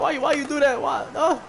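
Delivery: high-pitched imitated sobbing; acted tearful wail; mock crying. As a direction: plead in a high, whimpering mock cry. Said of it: "He's crying and shit".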